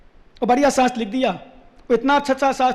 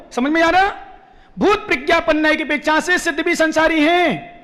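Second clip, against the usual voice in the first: raised, insistent doctrinal preaching; emphatic preaching; 4 semitones higher than usual. Real, not acted. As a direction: drive each point home to the congregation. Preaching at full force.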